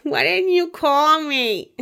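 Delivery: high, slow whiny plea. Pretend. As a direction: whine it out like a needy partner. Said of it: "I sound like a whiny".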